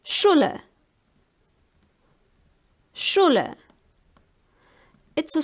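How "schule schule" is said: "Schule" opening on a clear sh; German SCH as SH. Shown as a demonstration. Teacher giving an example for learners, "Schule" with sh. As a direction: say "Schule" in German, SHOO-luh. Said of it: "is pronounced like sh".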